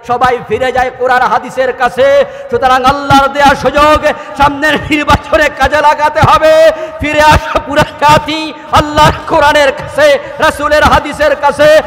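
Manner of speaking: high, very loud rallying sermon; full-force preaching. Real, not acted. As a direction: shout to rouse a whole crowd. (জোর গলায় উত্তেজিত ওয়াজ)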